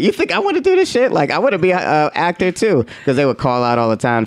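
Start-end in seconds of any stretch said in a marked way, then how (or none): 0.0-2.8 sudden much higher, indignant outburst; high-pitched bitter rant at coworkers; bitter retort. Retold or imitated. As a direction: snap back, mockingly wounded. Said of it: "'cause I'm like".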